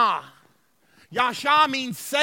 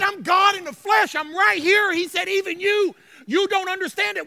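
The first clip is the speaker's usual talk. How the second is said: high, loud, urgent declaration; impassioned pleading; 6 semitones higher, 5 dB louder than usual. Retold or imitated. Preacher as Jesus to Jerusalem, impassioned pleading. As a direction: press it on people who cannot understand.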